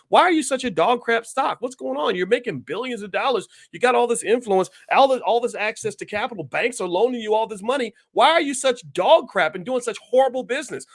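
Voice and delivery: high, loud accusing questions to the company; indignant interrogation. Acted. Demanding answers from Paramount about its spending, outraged scolding. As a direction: grill the company like it owes you answers.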